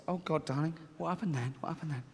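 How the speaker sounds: hushed father. Acted. Father pressing for details.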